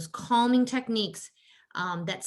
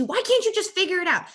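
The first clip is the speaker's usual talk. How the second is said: higher, exasperated nagging; mock the annoyed adult child with tech; irritated helper voice, lightly hammed; annoyed grown child; 9 semitones higher, 4 dB louder than usual. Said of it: "parents might be getting annoyed".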